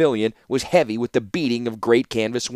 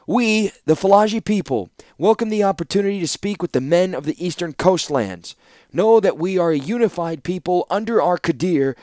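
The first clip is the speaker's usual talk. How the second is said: higher-pitched, declamatory envoy; louder proclamation; 6 semitones higher than usual. A narrator's reading. Envoy reading the ruler's statement over a complaining warlord, loud proclamation. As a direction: proclaim it like an official statement of a people. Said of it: "his words louder than the complaining warlord".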